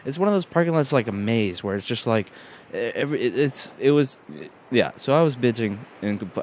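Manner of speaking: ordinary talk, nothing marked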